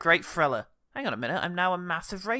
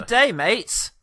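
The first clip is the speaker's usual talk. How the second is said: high, loud Aussie greeting; cheery Australian accent; 7 semitones higher, 6 dB louder, much slower than usual. Pretend. Jokey greeting in put-on accent.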